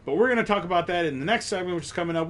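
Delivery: normal talking voice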